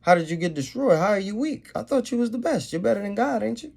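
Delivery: mocking the proud nation, high and jeering; sarcastic mockery. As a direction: taunt the fallen king.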